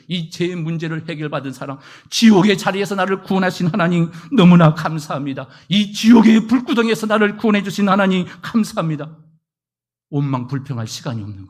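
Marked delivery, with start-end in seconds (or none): 2.1-9.2 louder, impassioned prayer of thanks; fervent thanksgiving. Retold or imitated. Believer rescued from hell thanking God loudly. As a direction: cry out your gratitude.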